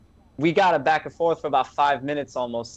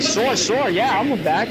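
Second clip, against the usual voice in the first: mock meek guy; 7 semitones higher, 3 dB louder than usual. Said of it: "He's like".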